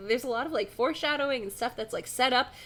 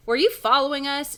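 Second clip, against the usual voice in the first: raised, louder accusing question; suspicious demand; 5 semitones higher, 7 dB louder than usual. A narrator's reading. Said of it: "Jason demands suspiciously".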